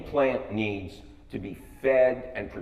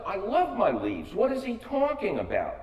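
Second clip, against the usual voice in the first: indignant surprise; 9 semitones higher than usual. Retold or imitated. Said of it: "And I go".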